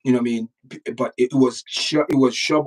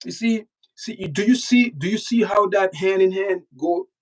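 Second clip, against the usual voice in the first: higher, pressing do you see; urgent insistence; 6 semitones higher than usual. Real, not acted. Host pressing his viewers to see the connection, insistent. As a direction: drive the point home, voice jumping up.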